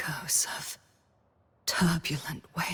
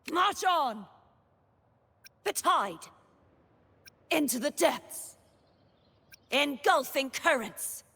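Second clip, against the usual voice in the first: high, commanding combat calls; battle cry; 6 semitones higher than usual. Acted. Knight calls on heavens and tides, battle cry. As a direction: call out the attack, pitched high.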